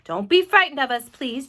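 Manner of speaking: loud, friendly plea; calling out. A narrator's reading. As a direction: call down to reassure the crowd. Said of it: "James called out".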